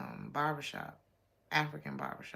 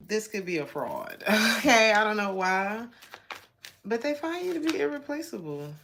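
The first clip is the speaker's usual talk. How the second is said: ordinary talk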